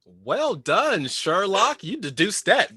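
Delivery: playful, swooping mock praise; theatrically singsong, rising high on each word; mock congratulation. Game player caught out by a correct guess, mock congratulation.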